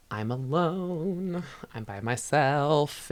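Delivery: slow, drawn-out singsong glee; mock stoked. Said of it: "stoked, and he's like".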